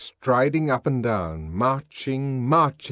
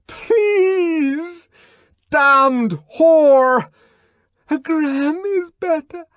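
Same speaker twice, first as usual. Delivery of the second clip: frightened crying plea; 12 dB louder, much slower than usual. Acted. Lenina crying out while shaken.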